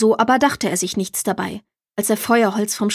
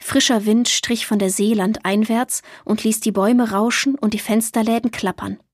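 ordinary delivery, nothing marked

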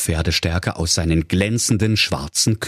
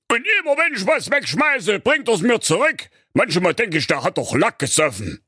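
king voice; 12 semitones higher than usual. Acted. King continues complaining.

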